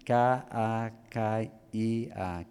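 K, A, K, I, A said slowly letter by letter; spelled out letter by letter. Shown as a demonstration. Preacher spelling the Greek word kakia slowly for listeners. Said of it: "caquía".

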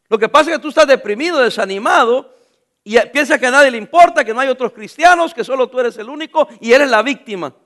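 loud preaching cadence, driving the point home; loud, fervent pulpit delivery; full-force preaching. Acted. Preaching at full force about Elijah.

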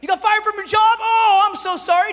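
high, loud, gushing fake sympathy; hammed-up concern from an oils devotee; mock oil seller. Mimicking an essential-oil seller's advice.

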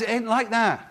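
usual speaking voice

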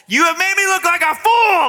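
high, loud, outraged yell; furious shout. Acted. Enraged Balaam yelling at his donkey, furious shout. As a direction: shout it at the top of your range. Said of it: "Balaam shouted".

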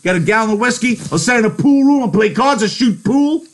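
very high, loud ranting tirade; furious rant. Acted. George raging at Lenny about his lost freedom, furious. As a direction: vent every frustration at him.

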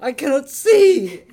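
slow, loud groan; zombie moan. Acted. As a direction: groan like a blind corpse. Undead Mary Poppins moaning.

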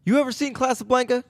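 high, eager question; lightly voiced Keenen; playing Keenen Ivory. Friend plays Keenen asking Seagal about Casablanca, eager. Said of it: "You be Keenen Ivory".

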